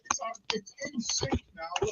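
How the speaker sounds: faint delayed echo through a speaker; distant repeat from another device; echo from second device. Real, not acted. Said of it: "There's still an echo".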